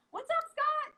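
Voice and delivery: high, lilting hello; bright greeting. Real, not acted. Host greets viewer Scott with a high lilting hello. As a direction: sing out a welcome.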